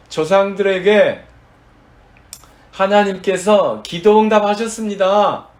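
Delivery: slow, loud, high preaching declaration; emphatic proclamation. Real, not acted. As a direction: proclaim it slowly at full force. Preacher proclaims answered prayer.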